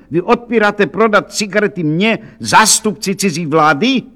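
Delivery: high, affronted outburst; indignant exclamation. A narrator's reading. Count refused cigarettes by shopkeeper, exclaiming indignantly. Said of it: "zvolal hrabě Rudolf z Kamelu".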